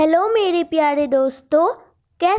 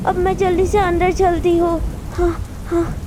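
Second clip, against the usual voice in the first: plain reading voice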